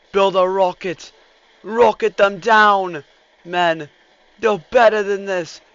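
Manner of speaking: frantic yelling commands; urgent shouts, chased; being chased. Real, not acted. Units fleeing and attacking.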